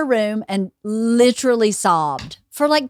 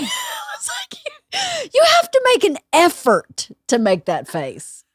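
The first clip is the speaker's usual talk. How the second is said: laughing; 12 semitones higher than usual. Real, not acted. Retelling, laughing hard.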